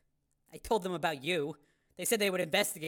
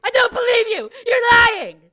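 loud, higher, tearful accusation; crying shout; 14 semitones higher, 13 dB louder than usual. Acted. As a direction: Janice screaming at Steele through tears, crying shout.

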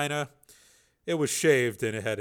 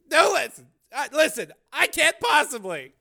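flustered refusal; 7 dB louder than usual. Acted. Tracy refuses again, flustered.

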